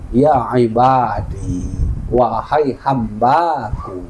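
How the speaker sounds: slow, low, drawn-out tender address; tender call. Acted. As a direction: call gently, stretching each word out. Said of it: "bukan dengan hinaan".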